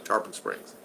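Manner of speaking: breathy whisper of the name; just breathe the name out; a hushed aside to himself. Shown as a demonstration. Host repeating a place name.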